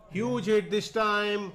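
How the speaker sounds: high, loud, slow; excited call. Real, not acted. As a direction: call the big hit. Big six called.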